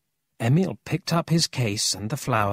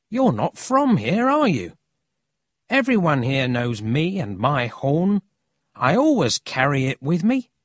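put-on higher-pitched, lively boy's voice; cocky local boy; 4 semitones higher, 3 dB louder than usual. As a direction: play a boy proud of his horn.

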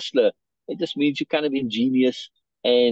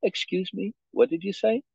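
polite but not warm; 8 semitones higher, 4 dB quieter than usual. Acted. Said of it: "I said politely, but not warmly".

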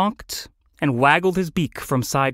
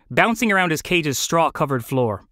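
plain reading voice

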